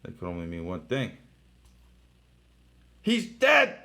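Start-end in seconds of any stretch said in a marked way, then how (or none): 3.0-3.8 high, loud, mock-shocked cry; dramatic exclamation. Reading the comic to viewers, high loud mock shock. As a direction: announce the death like a cliffhanger.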